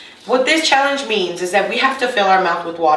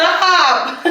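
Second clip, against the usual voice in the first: laughing; 9 semitones higher than usual. Real, not acted. Laughing protest.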